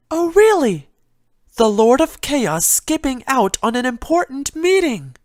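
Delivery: a timid pony fretting over a broken vase; Fluttershy's voice. Acted.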